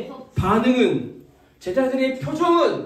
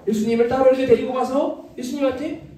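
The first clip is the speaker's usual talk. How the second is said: usual speaking voice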